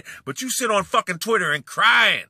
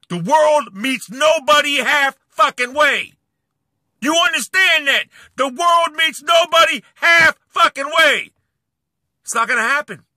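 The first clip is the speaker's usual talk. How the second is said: angry shout; 8 semitones higher, 6 dB louder than usual. Real, not acted. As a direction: drive the point into him. Lecturing rival angrily.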